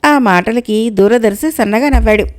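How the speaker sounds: ordinary delivery, nothing marked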